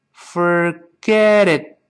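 syllables stretched far apart; very slow model. Shown as a demonstration. Teacher breaks it down for students, very slow. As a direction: draw out "Forget it" piece by piece.

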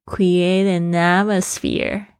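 very slow, flowing "create an atmosphere"; lingering linked sounds. Shown as a demonstration. Teacher showing the sing-like liaison of English, lingering linked sounds. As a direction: let "an" ring on into "atmosphere", unhurried. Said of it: "那个音又跟后面的声音结合在一起".

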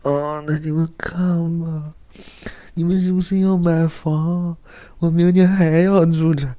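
high coy falsetto; coy girl voice. Retold or imitated. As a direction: play the pampered tenant teasing. Mimicking the coy tenant again.

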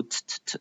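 soft, barely sounded "t-t-t"; only shape "t-t-t" with the tongue, without releasing it; faint tongue-tip "t-t-t", no voice; unreleased t. Shown as a demonstration. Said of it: "不发出这个音，只做出t-t-t的动作".

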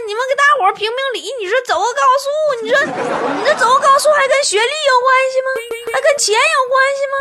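high, pleading for someone to stand up for him; pleading Tuotuo. Retold or imitated. Tuotuo begging his colleagues to judge, high and pleading. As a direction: beg the colleagues for justice, high and whiny. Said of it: "说的".

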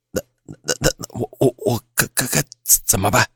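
frightened stammer, words repeated and broken; scared, halting, stuck on each syllable; trembling voice. A narrator's reading. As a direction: stutter in fear, barely getting the question out. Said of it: "我自己的声音都在颤抖".

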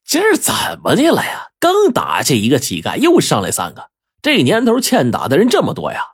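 exasperated anger, voice jumping up; angry outburst. Acted. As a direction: fume at the nerve of them. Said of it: "就不禁大怒".

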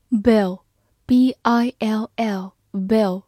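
bill spelled B I L L very slowly; slow spelling drill. Shown as a demonstration. Teacher spelling bill slowly for students. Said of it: "字母I发短音A".

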